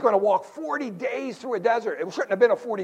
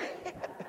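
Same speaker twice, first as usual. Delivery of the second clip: breathy laugh; 16 dB quieter than usual. Real, not acted. Laugh after story.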